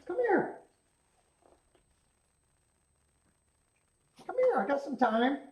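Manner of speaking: high, soft coaxing call to a pet; coaxing the dog. Real, not acted. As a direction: sweet-talk the dog into coming over.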